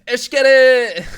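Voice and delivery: high, loud rapper ad-lib. Retold or imitated. Streamer hyping chat with a loud rapper shout.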